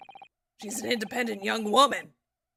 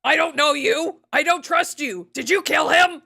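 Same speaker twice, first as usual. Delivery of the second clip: louder, higher accusing shout; Sandman shouting; 5 semitones higher, 6 dB louder than usual. Retold or imitated. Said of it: "Sandman goes like".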